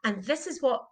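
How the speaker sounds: ordinary talk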